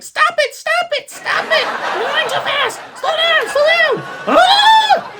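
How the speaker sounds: very high, loud hammed-up panic; mock panicked shrieking. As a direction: shriek it like a terrified passenger, overacted. Acts out the panicking boss.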